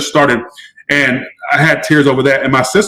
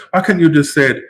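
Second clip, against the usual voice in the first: ordinary talk, nothing marked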